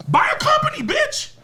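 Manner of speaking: yelled way up high and loud; furious shout. Real, not acted. Podcast host yelling at complaining artists, furious shout. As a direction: blast it at them like a rant peaking.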